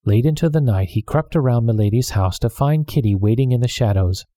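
plain reading voice

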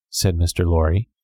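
ordinary delivery, nothing marked

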